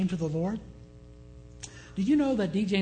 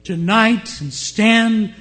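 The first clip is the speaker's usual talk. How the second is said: much louder, slow booming radio preacher; radio voice booming into the bedroom at noon; 8 dB louder, slower than usual. Retold or imitated. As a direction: boom it out like a voice filling the room. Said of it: "which suddenly boomed".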